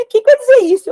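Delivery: high, loud burst of disbelief; retold amazed question. Husband asking what it means, retold amazement. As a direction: ask it high and loud, astonished.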